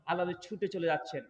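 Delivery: ordinary delivery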